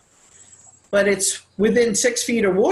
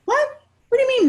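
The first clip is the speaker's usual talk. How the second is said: shocked what; faster than usual. Retold or imitated. Son hears shocking news from mom.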